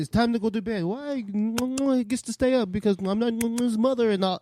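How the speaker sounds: high-pitched, badly faked African accent. Child in the village complaining about bedtime, bad African accent. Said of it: "That's your Bufu voice".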